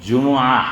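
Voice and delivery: "جمعہ" as ju-mu-ah, vowel after the meem; correct Arabic pronunciation. Shown as a demonstration. Preacher introducing the word he will correct, careful Arabic pronunciation. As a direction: say "جمعہ" carefully in three syllables, ju-mu-aa. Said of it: "سب سے پہلے ایک چیز کی تصحیح کر لو".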